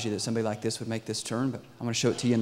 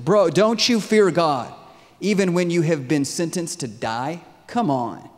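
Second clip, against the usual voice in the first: loud, higher indignant rebuke; heated acted objection; arguing protest; 5 semitones higher, 8 dB louder than usual. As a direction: scold him forcefully, stretching the words. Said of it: "protested and started arguing with that criminal".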